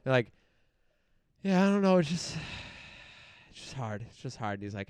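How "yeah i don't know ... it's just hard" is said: deflated, sighing, trailing to near breath; sighing it's just hard, worn out; mock depressed sigh. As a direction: play the beaten-down guy giving up. Depressed man retold, sighing.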